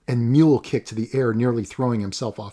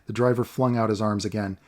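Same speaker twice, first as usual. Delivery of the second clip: ordinary delivery, nothing marked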